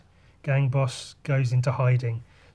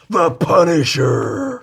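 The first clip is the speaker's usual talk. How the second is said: louder, slow, drawn-out name reveal that falls flat; weak dramatic announcement; 3 semitones higher, 9 dB louder, slower than usual. Acted. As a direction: try a big dramatic intro but come up short. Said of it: "Ugh, that was really weak".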